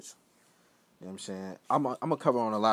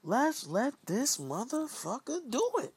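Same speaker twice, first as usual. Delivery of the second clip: much higher, slow, put-on decider; imagined exec voice; slower than usual. An imagined executive approving him, in a high, slow put-on voice. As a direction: play a big shot giving the okay. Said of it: "and they said".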